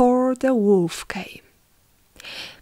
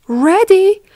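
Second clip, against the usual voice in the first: loud, very high one-word reply; triumphant little pig; 11 semitones higher, 11 dB louder than usual. Acted. Pig answering the waiting wolf, triumphant.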